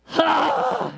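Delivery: loud, high outburst trailing down; wordless groaning cry. Acted. Praying person out of words, loud acted cry.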